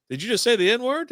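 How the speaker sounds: high-pitched incredulous question, acted out; mock astonishment, pitched well up; retold disbelief. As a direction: imitate a stunned gamer double-checking. Retold player challenges the slur.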